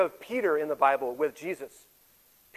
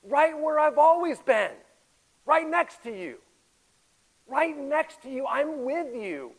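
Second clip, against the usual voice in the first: God's tender reply; 8 semitones higher, 3 dB louder, slower than usual. Acted. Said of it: "God's response in looking us in the face".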